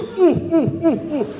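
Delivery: high, loud falling hoots; Persian alarm hoot. Shown as a demonstration. Comedian showing crowd a Persian warning noise, alarmed hooting. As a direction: imitate a hooted warning of something horrible. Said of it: "something horrible about to happen".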